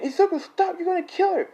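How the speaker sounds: high, urgent warning; mock panicked shouting. Everybody watching Deku choke her, mock panicked warning. Bystanders alarmed, acted.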